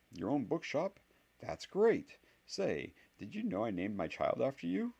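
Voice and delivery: high, gushing fan voice; gushing admirer. Retold or imitated. Harry imitating a fawning fan asking about his life, gushing. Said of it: "Harry mimics imagined admirers".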